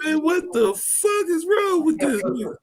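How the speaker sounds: a hammed-up baffled reaction; mock disbelief. Retelling his own reaction to Savio, mock disbelief. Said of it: "I'm like".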